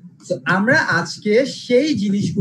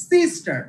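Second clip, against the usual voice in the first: Sister with সিস high, then a sharp fall; first-syllable stress; 8 semitones higher, slower than usual. Shown as a demonstration. Teacher giving a noun example, stress on সিস in sister. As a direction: punch the first syllable of sister, higher pitched. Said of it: "first যে সিলেবলটি আছে সেটিকে pressure দিব".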